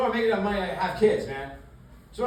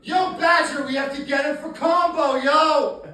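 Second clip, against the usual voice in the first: loud, high yo-yelling slacker; Jesse Pinkman impression; 7 semitones higher, 5 dB louder than usual. Breaking Bad kid yelling at his teacher, loud and high. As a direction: yell it like a wired young dealer. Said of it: "I can do the kid from Breaking Bad".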